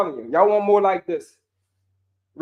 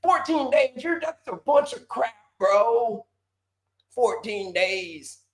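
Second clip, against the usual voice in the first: higher, scoffing doubter, drawn-out last "Fourteen days"; mock skeptic viewer; 4 semitones higher, slower than usual. Coach voicing a viewer calling his claim crap, mocking scoff. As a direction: sneer it like you don't buy a word. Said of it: "When people coming on here like".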